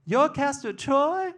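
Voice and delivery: put-on very high, drawn-out questioning girl voice; Sandy. Sweetheart questioning her man, very high voice. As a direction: squeak it as an astonished question.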